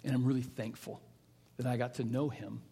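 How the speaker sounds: soft, low, halting tribute; tender grief. Real, not acted. Pastor remembering a friend he misses, tender and subdued. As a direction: let it drop quiet and low, with pauses.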